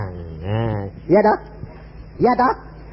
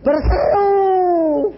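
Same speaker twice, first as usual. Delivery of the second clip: high, very slow proclamation of Berseru; drawn-out call; 14 semitones higher, 6 dB louder, much slower than usual. Acted. Preacher crying out the word to the congregation, long and high. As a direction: stretch Berseru out like a rallying cry.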